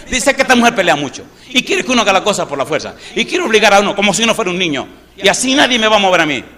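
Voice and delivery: loud, high, rapid-fire mock rant; complaining husband. Husband complains to the pastor about his wife, indignant rant.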